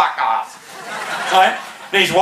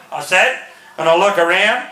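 usual speaking voice

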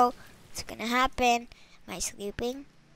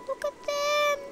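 high, soft little squeal; adoring coo; 11 semitones higher, 8 dB quieter than usual. Real, not acted. Player adoring the cats on screen, soft high coo. Cooing over game cats.

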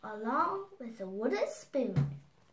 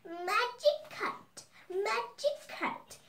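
witch voice; 8 semitones higher, slower than usual. Acted. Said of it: "Baba Yaga stood ... and said".

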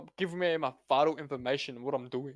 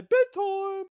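mock parent voice; 14 semitones higher, 7 dB louder than usual. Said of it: "then you say".

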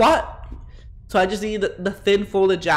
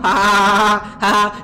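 loud, forced ha-ha laughter; exaggerated laugh; 2 semitones higher, 6 dB louder than usual. Streamer laughs at his mom joke, exaggerated laugh. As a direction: laugh heartily at your own joke.

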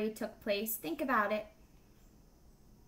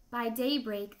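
ordinary delivery

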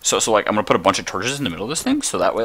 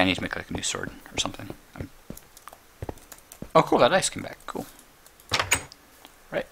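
ordinary delivery

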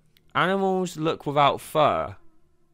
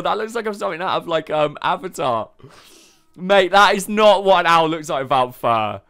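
ordinary delivery

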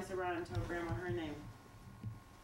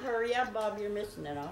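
normal talking voice

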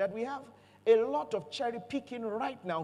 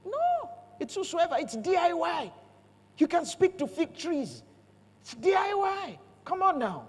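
higher pitched, building preacher's fervour; rising preaching; 8 semitones higher than usual. Real, not acted. Preacher urging the congregation, rising excitedly. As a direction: climb in pitch and press each claim.

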